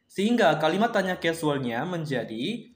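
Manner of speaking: ordinary talk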